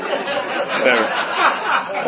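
high, drawn-out squeak of fake crying; mock weeping. Comic brushing off the movie that makes him cry, mock weeping. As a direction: choke it out slowly, comically high.

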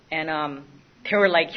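usual speaking voice

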